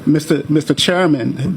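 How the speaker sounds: ordinary delivery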